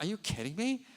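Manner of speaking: light mock astonishment; retold disbelief. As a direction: speaker hearing the superintendent's news, amazed. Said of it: "I'm like".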